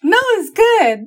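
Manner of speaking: high, slow playful insistence; high mock protest. Real, not acted. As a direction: protest sweetly, drawn out. Defending her silly poem.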